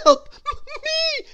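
very high, loud stuttered cry; terrified stammer. A narrator's reading. Mister Jelly calls the police, stammering in fear. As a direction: stammer out a high-pitched plea. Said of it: "he stammered".

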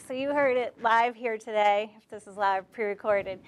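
normal talking voice

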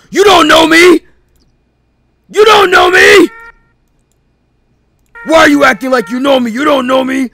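high, loud shouted denial; dramatic denial. Acted. Captor to Tyrell denying he knows him, shouting. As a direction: yell it like a soap villain. Villain denies identity, shouting.

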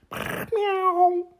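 a small, high cat meow; high kitten meow. Acted. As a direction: mew like a little kitten. Kitten sound.

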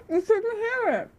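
talking through a full mouth; muffled by a mouthful of food. Real, not acted. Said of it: "And my mouth is too full".

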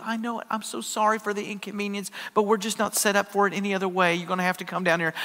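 soft, sorry receptionist voice; so apologetic. Retold or imitated. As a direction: imitate a sweet gal apologizing softly. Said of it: "she was so apologetic".

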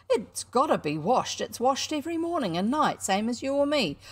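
cocky showman standing his ground; indignant boy showman. Acted. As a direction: insist like a boy caught out. Showman defending his rat.

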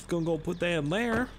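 usual speaking voice

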